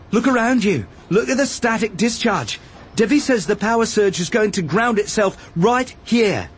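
high, insistent warning; urgent argument. A narrator's reading. Obi-Wan showing Arad the danger around them, urgent argument.

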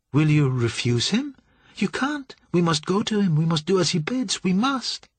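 high, urgent plea; anxious pleading. A narrator's reading. Cynthia begs Julian to obey.

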